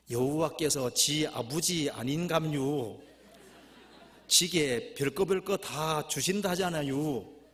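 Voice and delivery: slow, loud Gangwon reading; Gangwon dialect. Acted. Preacher reading the Gangwon version of the verse, Gangwon dialect. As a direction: stretch each phrase ending in Gangwon dialect. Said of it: "강원도 버전".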